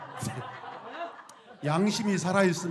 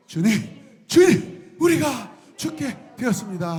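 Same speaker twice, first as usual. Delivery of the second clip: desperate cry; 6 semitones higher, 7 dB louder, slower than usual. Acted. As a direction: pitch climbs to a panicked high shout. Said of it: "하고 부르죠".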